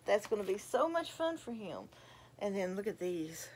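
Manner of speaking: ordinary talk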